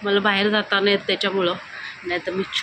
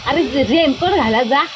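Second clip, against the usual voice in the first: calling out; 6 semitones higher, 5 dB louder than usual. Real, not acted. Calling to husband again.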